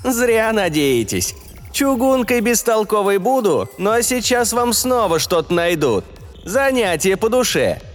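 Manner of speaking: high, mocking machine voice; snide AI voice. A narrator's reading. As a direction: sneer it out, high-pitched. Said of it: "Он снова мерзко хихикнул".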